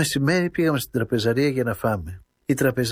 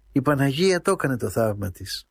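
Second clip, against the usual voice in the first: plain reading voice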